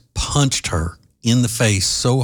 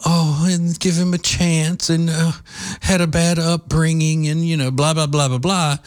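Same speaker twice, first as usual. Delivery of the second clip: mock bleeding-heart liberal; 7 semitones higher than usual. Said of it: "old school liberals, and they're".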